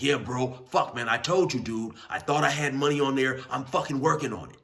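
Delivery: heated big inmate. Retold or imitated. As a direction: push back loudly against him. Big dude argues back.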